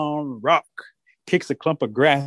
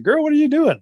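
high, loud grandma call; 13 semitones higher, 5 dB louder than usual. Acted. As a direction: holler over to the girls. Grandma calling to the girls.

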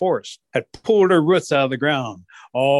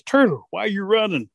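higher-pitched urgent question; calling out; 6 semitones higher than usual. Acted. Rabbit asks Turtle why he's running, calling out.

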